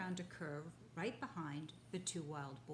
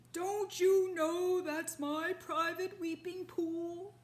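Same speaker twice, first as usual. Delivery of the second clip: much higher, louder, slow angry bellow; angry deep voice; 11 semitones higher, 12 dB louder, slower than usual. Acted. Rhinoceros catching the father in his pool, angry bellow. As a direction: roar at the trespasser. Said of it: "said an angry, deep voice".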